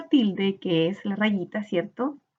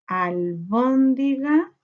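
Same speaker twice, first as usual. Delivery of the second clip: "albóndiga" very slow, al-bón-di-ga, rising at the end; syllable by syllable; 2 semitones higher, much slower than usual. Shown as a demonstration. Teacher counting syllables, "albóndiga" slowly piece by piece. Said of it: "¿Cuántas sílabas tiene?".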